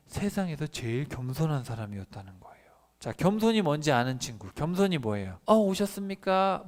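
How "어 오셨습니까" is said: higher, louder, slow bowing greeting; mock deferential welcome; overly polite greeting. Shown as a demonstration. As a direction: greet like a fawning servant.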